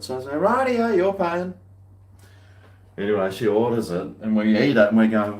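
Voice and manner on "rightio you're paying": high, loud mock concession; acted-out giving in, pitched up; retold retort. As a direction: toss back a high-pitched, exaggerated comeback. Retells his reply to her.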